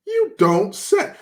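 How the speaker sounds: high, slow drawn-out sarcasm; sarcastic mock surprise. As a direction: stretch it out, mock amazement.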